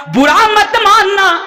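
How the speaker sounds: high, slow, ringing appeal; emphatic plea. Real, not acted. Preacher asking his brothers not to take offence, ringing plea. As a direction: call it out with drawn-out words. Forceful first plea to congregation.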